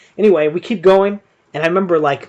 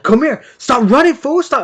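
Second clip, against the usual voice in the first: louder, higher mock-angry call after a fleeing opponent; retold angry taunt; 9 semitones higher, 6 dB louder than usual. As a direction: bark it at him, raised and riled. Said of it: "like, super angry".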